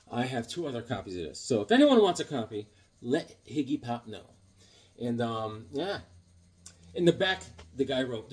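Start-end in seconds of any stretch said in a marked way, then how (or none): none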